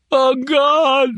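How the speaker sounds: high pained scream. Acted. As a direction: cry out in agony. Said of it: "screamed Lampert".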